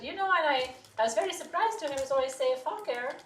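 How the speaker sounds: lightly imitated Russian teacher; a touch of a thick Russian accent. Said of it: "Very thick Russian accent".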